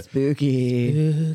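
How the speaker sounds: drawn-out, mock-eerie delivery; playful spooky voice. Co-host introducing Spooky Friday segment, playful spooky voice. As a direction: stretch it out like a ghost. Co-hosts chant segment title.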